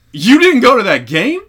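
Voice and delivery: high, very loud incredulous cry; imitated astonished shout; shocked disbelief. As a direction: yell the question in acted outrage. Acting out film scene's shocked reaction.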